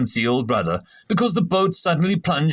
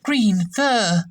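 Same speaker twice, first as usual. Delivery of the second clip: a higher, eager blurt; lightly voiced eager officer; 7 semitones higher than usual. A narrator's reading. Shrub blurting out to the captain, lightly voiced. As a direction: pipe up quickly. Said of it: "Shrub said".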